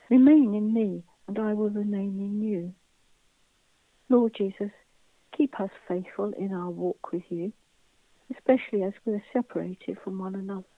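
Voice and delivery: usual speaking voice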